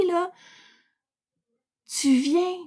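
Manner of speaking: ordinary delivery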